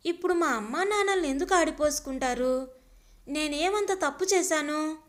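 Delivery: plain reading voice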